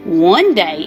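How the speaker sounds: plain reading voice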